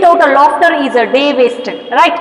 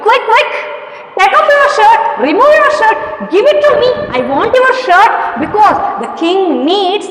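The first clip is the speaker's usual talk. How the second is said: high, pressing demand; urgent boy; 6 semitones higher than usual. Shown as a demonstration. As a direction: hurry him like an impatient little boy. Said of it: "Observe the tone of that boy".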